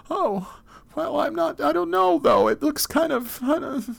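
very high, loud screaming panic; terrified. Acted. Lost adult in the wilderness, screaming in terror at everyday objects. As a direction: shriek that the thing will attack.

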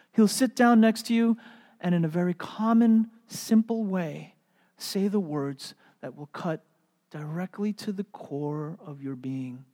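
usual speaking voice

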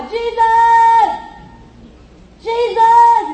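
high, sustained shout for help; crying out loud. Acted. Blind man calls to passing Jesus, crying out loud. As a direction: call out loud, drawing out the name. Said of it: "starts to cry loud".

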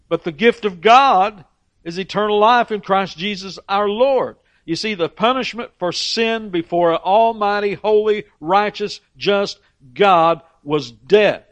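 fervent preaching. Acted. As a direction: proclaim it from the pulpit, building. Preaching climax on God's gift.

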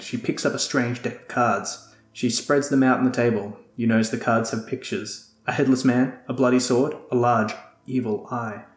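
ordinary talk

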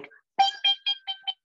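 very high, ringing bing bing like a bell going off; ding it out like a pinball machine lighting up; brain dinging. Retold or imitated. Brain lighting up at the first drink, ringing high bings. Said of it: "And it's like".

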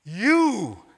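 loud, high outburst of disbelief; shocked pastor's exclamation, loud and high; exclaimed. A narrator's reading. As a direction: burst out at him. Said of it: "exclaimed the pastor".

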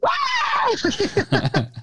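high laughing wail; mock toddler wail. Retelling a toddler's video to a friend, laughing wail. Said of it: "You're like".

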